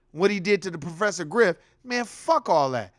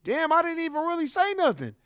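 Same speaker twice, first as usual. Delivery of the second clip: higher, baffled mock protest; imitating his homeboy; 9 semitones higher than usual. Homeboy rejected by the girl, higher baffled mock protest. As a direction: play the confused friend, pitched up. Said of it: "He was like".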